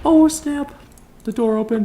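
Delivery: very high, squeaky exclamation; high-pitched playful yelp; mock surprise. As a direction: squeal it in a tiny put-on voice.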